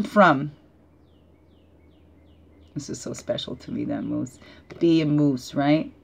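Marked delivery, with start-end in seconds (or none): 2.7-4.4 whispered, touched by the card; a soft, moved whisper; hushed and moved. Real, not acted. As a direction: hush it like a secret treasure.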